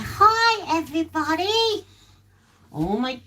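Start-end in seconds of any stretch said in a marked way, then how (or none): 0.0-1.8 high, cutesy dog voice; squeaky pet greeting; voicing the dog. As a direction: speak for the dog to the viewers.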